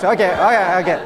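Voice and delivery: ordinary talk, nothing marked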